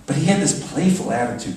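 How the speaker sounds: ordinary delivery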